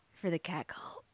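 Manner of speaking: hushed whispered aside. Real, not acted. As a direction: drop to a secret whisper.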